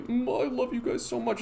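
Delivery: high, soft gushing voice; fake sweet influencer. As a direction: coo it insincerely. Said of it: "in a way that's like".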